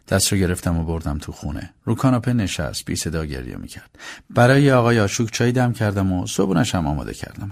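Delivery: plain reading voice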